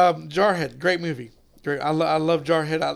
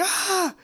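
imitated cry; 13 semitones higher, 4 dB quieter than usual. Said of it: "he's like".

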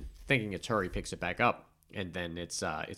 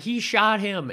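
higher, louder mock outcry; imitating alarmed accusation; 11 semitones higher, 7 dB louder, slower than usual. Bystanders accusing Stu of the shooting, imitated alarmed outcry. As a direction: mimic a bystander crying out.

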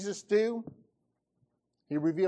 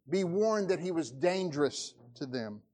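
ordinary talk

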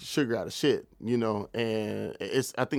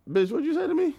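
a much higher, incredulous retort; turning on her in mock outrage; outraged squeal. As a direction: shriek it back in disbelief. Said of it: "I was like".

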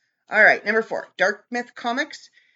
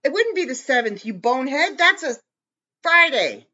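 louder, higher exasperated correction; louder exasperated scolding of a co-host; 5 semitones higher, 5 dB louder than usual. Real, not acted. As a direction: scold him like a bonehead. Arguing with co-host over dates.

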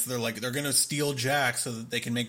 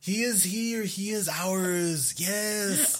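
slow, high, hammed-up fake Mexican accent; mocking the movie's fake Mexican accent, slow and high; bad Mexican accent; 5 semitones higher, much slower than usual. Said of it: "They do the accent".

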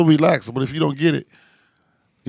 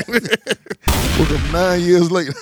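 laughing; 3 semitones higher, 4 dB louder, much slower than usual. Real, not acted. Laughing punchline.

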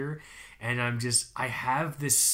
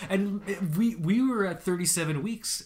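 ordinary delivery

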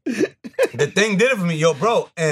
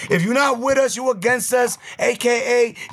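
hype podcast host; 7 semitones higher than usual. Acted. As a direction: pump up the live audience like an MC. Hosts the show intro.

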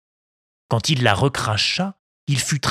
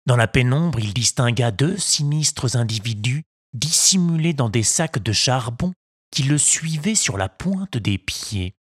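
plain reading voice

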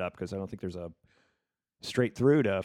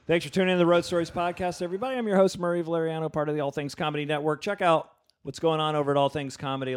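podcast host intro; 6 semitones higher, 5 dB louder than usual. Acted.

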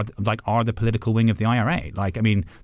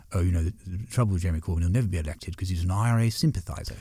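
posh judge voice; 2 semitones lower than usual. Retold or imitated. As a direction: imitate a posh English judge holding forth. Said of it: "Your posh voice is really good".

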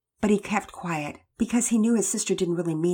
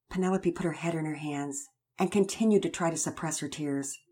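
plain reading voice

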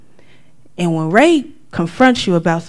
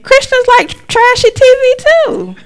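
hammed-up whiny self-defence; high mock justification; 8 dB louder than usual. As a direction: whine the excuse up high. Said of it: "I justified it by saying".

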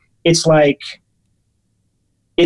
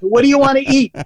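higher-pitched imitation of a pushy waiter; super aggressive waiter; 8 semitones higher than usual. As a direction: demand the order, mock-aggressive. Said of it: "Really super aggressive".

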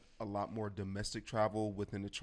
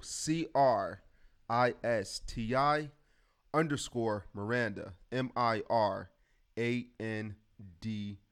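spelling slowly; slower than usual. Shown as a demonstration. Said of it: "spelling out the winner's handle".

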